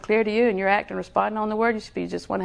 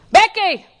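shouted name, much higher and louder; loud call; 11 semitones higher, 10 dB louder than usual. Retold or imitated. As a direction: call her name sharply to wake her. Lord calling her name loudly.

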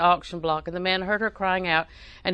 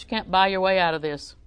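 normal talking voice

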